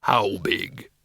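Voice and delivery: low, tentative bogeyman; deep, curious question; exploratory tone. A narrator's reading. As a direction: probe cautiously in a deep creature voice. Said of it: "in an exploratory tone of voice".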